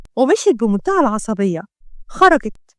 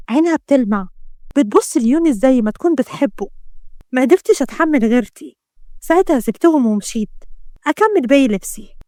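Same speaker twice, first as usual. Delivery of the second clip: plain reading voice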